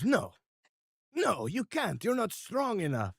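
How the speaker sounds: panicked Mister R. Acted. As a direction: beg in a high panic. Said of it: "Mister R goes".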